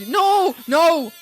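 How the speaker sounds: high, loud outburst; shouted no. Acted. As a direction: cut it off with a yell. Shouted refusals.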